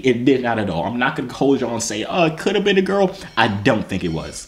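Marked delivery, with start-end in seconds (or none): 2.0-3.2 put-on doubter. Imagined hedging quote, put-on high voice.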